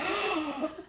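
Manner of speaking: high, sharp intake of breath; mock alarmed gasp. Student warned of burger flipping by mom, mock alarmed gasp. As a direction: gasp as if suddenly scared straight.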